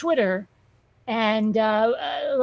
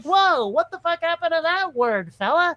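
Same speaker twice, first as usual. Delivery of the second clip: high, baffled mock outburst; thrown by foreign word; 5 semitones higher than usual. Diner thrown by a waiter's Italian, higher baffled mock outburst. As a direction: act stunned by the word mid-sentence. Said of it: "And he's like".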